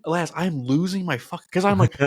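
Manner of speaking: usual speaking voice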